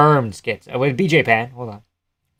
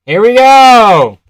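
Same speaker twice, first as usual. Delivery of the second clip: fight announcer; 10 semitones higher, 10 dB louder, much slower than usual. Pretend. Hyping the fight start, announcer-like.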